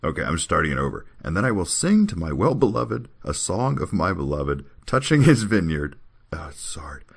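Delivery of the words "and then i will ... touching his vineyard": higher, swinging recitation; scripture reading. Acted. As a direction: declaim it, holding back the laughs. Reads scripture aloud, restarting.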